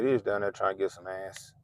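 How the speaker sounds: ordinary delivery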